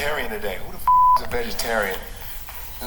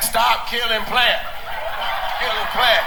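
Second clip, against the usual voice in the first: mocking meat eater; 7 semitones higher than usual. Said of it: "Meat eaters would be like".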